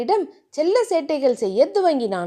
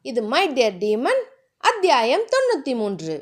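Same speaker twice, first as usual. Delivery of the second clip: plain reading voice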